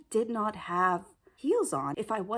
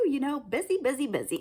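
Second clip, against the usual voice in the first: breezy brush-off; 4 semitones higher, faster than usual. Retold or imitated. Brushing off with excuses.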